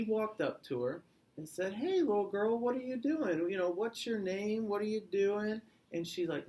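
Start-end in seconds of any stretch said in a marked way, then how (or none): 1.7-5.6 higher-pitched wolf character voice; pitched-up storybook wolf; wolf accosting girl. A narrator's reading. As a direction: put on a nosy, higher wolf voice.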